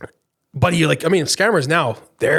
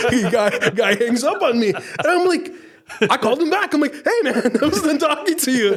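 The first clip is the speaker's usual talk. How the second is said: high, giggly retelling through real laughter; laughing while talking; 8 semitones higher than usual. Real, not acted. Telling co-host about the scammer hanging up, laughing. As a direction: crack up as you tell it.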